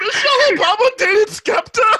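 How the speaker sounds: high, loud shout through laughter; laughing disbelief. Real, not acted. Hearing the [name] rumour, a high laughing shout of disbelief. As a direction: cry out in amused shock.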